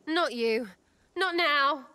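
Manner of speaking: sudden loud high snap; upset outburst. Real, not acted. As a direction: blurt it out, rattled. Startled, pushing away.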